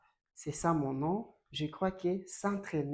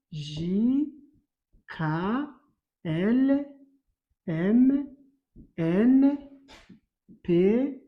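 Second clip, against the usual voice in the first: loud consonant drill; 5 dB louder, much slower than usual. Shown as a demonstration. Teacher recites the consonants for students, loud and clear. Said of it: "Donc, il y a".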